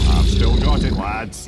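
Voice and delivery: loud, proud crow of success; triumphant. Real, not acted. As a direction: shout it out, chuffed with yourself. Pleased the serpent answered.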